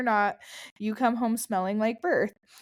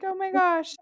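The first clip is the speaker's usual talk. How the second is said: surprised; 9 semitones higher than usual. Retold or imitated. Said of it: "you'll be like".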